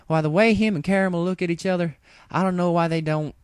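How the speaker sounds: high, quickening, chatty farm-pony voice; Applejack gossip. A narrator's reading. Applejack chattering to Rainbow about Big Mac, high and fast. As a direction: rattle on about her brother.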